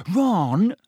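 sharp, high warning; sharply. Acted. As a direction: cut him off sharply. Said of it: "said Hermione sharply".

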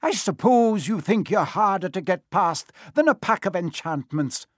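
high-pitched angry scolding; storming. A narrator's reading. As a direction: tear into them as a teacher who lost her temper. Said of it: "she stormed".

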